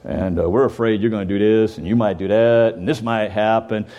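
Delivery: lightly voiced nervous elders; worried fellas. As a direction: voice the anxious old men fretting. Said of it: "these fellas are going".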